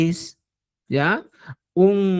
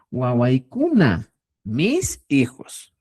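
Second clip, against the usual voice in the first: slow teaching; much slower than usual. Shown as a demonstration. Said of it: "teacher drills the word slowly".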